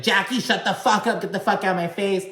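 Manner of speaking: high-pitched imitation of an angry snap; pissed-off outburst. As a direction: mimic a furious telling-off, voice pitched right up. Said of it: "Teresa gets all pissed".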